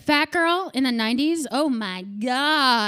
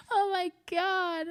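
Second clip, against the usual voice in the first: anguished wail; 7 semitones higher, 3 dB quieter, slower than usual. Acted. Comic despairing over herself, slow anguished wail. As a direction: groan to the heavens.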